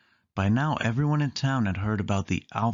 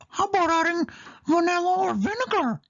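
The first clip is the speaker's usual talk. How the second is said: much higher cartoon mouse voice; Mickey voice. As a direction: suggest it cheerily as Mickey. Mickey suggests ingredients.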